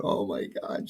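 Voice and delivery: mock-distraught, lightly acted; imitated tearful upset. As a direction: imitate someone starting to cry, at low volume. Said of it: "She starts crying".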